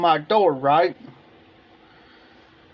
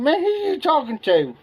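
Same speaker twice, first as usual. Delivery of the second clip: higher, incredulous man who you talking to; incredulous retort; 9 semitones higher than usual. Retold or imitated. Homeowner retorting to the salesman at his door, incredulous. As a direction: challenge him, half amused and disbelieving.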